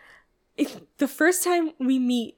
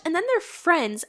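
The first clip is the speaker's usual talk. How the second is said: normal talking voice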